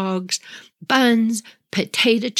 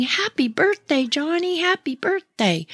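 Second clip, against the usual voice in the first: higher, sing-song parent greeting; cheery parents; 9 semitones higher than usual. Retold or imitated. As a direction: chirp it like polite parents at the door.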